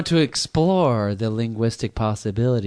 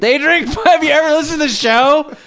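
shocked, much higher and louder, laughing; can't believe she doesn't know; incredulous; 14 semitones higher, 7 dB louder than usual. Real, not acted. Host reacting in disbelief to a caller, high and loud.

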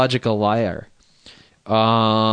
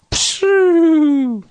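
high, louder imitated "pshoo" of someone passing out; mock phone-slump noise; 5 dB louder than usual. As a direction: blow out a silly high collapse sound. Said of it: "the guy's just like".

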